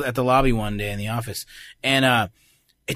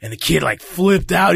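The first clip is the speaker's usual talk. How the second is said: normal talking voice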